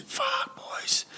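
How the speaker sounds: barely voiced, the breath knocked out; stunned whisper. Retelling telling the boys his sentence, stunned whisper. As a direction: whisper it, winded by the news.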